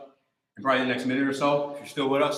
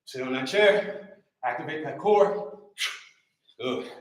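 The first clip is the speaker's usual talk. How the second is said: energetic live trainer calls, a bit raised; workout coach cueing; 3 semitones higher than usual. Acted. Coach calling out moves to a live workout class, energetic. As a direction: drive the class through each rep.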